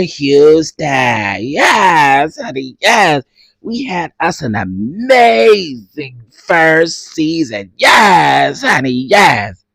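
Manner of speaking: hammed-up reality reunion host; reunion show host. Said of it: "this what gonna be like right here".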